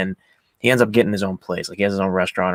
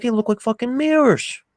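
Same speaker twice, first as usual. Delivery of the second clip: high-pitched mock praise; imitated teasing boast; 13 semitones higher than usual. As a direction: play a loudmouth ribbing someone. Acting Billy's teasing boast.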